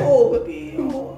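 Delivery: put-on high, drawn-out cooing baby talk. Shown as a demonstration. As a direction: coo it like talking to a baby. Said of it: "Você fala".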